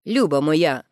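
ordinary delivery, nothing marked

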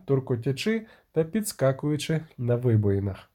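ordinary delivery

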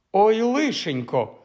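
high, drawn-out cry of dismay; acted high yelp of distress; dismayed wail. A narrator's reading. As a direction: wail it slowly in alarm. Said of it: "зойкнула Фанні".

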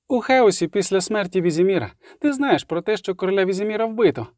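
high-pitched bard telling news; bard's voice. Acted. Любисток briefing the witcher, high-pitched bard voice. Любисток figure in story dialogue.

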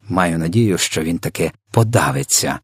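ordinary delivery, nothing marked

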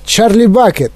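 high-pitched calling out; mild shout to get attention; Містер Вонка. A narrator's reading. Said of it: "крикнув містер Вонка". (стриманий оклик, високим голосом)

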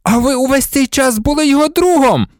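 high, furious accusation; agitated cry of betrayal; voice breaking. A narrator's reading. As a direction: hurl it out, overwrought. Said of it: "Його голос зривався від хвилювання".

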